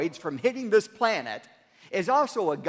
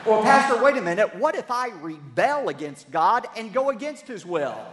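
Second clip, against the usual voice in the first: exasperated congregant; 4 semitones higher, 7 dB louder than usual. Retold or imitated. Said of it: "imagined congregant objecting".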